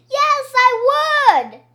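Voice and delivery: high, loud, eager insistence; shouting. A narrator's reading. Pupil insisting to teacher about the books, shouting. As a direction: shout back in eager protest. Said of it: "I shouted".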